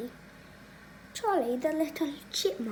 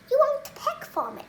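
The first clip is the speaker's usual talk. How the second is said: whiny complaint to pet; 11 semitones higher, 5 dB louder than usual. Real, not acted. Complaining to the bird.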